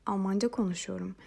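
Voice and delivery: ordinary talk, nothing marked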